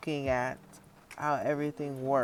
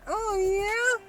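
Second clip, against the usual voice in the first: much higher, teasing imitation; squeaky mock moan. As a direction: quote him back mockingly. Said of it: "bass than how he said that".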